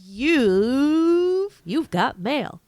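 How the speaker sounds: high, loud announcer call. Pretend. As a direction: sing it out like the AOL alert. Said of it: "like the little robot voice".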